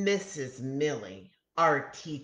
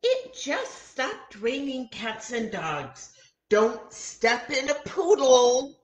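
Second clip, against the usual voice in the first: high teacher voice, louder at the end; a silly teacher warning the kids; high sing-song warning; 8 semitones higher, 6 dB louder than usual. A narrator's reading. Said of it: "Our teacher looks out".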